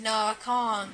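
usual speaking voice

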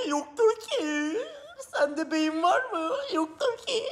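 high, slow, whimpering mock sob; mock crying. Teacher mocks students by fake crying, high and slow. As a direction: whine it like a crybaby student.

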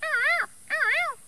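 very high, slow squawking cockatoo cry; cockatoo screech. Retold or imitated. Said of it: "it's like".

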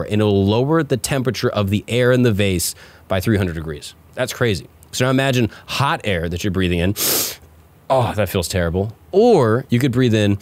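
smooth pitchman sponsor read. Acted. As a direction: sell it like an ad.

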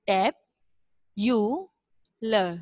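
टैब, यू, लर with a pause after each syllable; broken into syllables. Shown as a demonstration. Teacher breaking a hard word up for pupils, syllable by syllable. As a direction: read टैब, यू, लर one separate syllable at a time. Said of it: "इसको जो है वो break कर करके पढ़ें".

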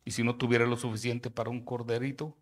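preacher reading Leviticus at full force; forceful scripture reading. Acted. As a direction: proclaim each clause to the hall.

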